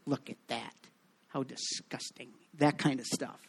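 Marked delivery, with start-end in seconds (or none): 0.0-2.3 soft, catty tut-tutting; hushed disgusted gossip; mock church-lady disapproval. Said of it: "the church ladies would be chirping".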